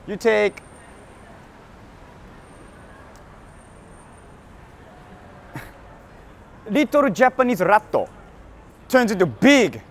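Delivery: put-on Japanese-accented salaryman reading an ad; a Japanese salaryman's accented sales pitch; Salaryman Satoshi. Sponsor ad read as a Japanese salaryman, in a Japanese accent. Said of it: "I'm gonna read it like Salaryman Satoshi".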